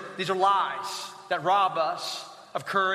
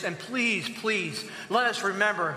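usual speaking voice

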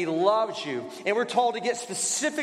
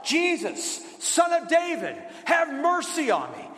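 higher pleading cry; louder calling out; 6 semitones higher than usual. Retold or imitated. Blind man shouting louder over the crowd to Jesus. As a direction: call out even louder for mercy. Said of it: "shout all the louder".

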